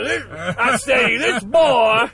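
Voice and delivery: loud, high hammed-up Southern orator; Al Pacino Southern accent. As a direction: shout it like a Southern general. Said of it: "leghorn Southern accent".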